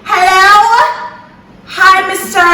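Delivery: high, loud mock-British greeting; put-on British accent. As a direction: play at grown-up British on the phone. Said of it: "That was how sis was sounding".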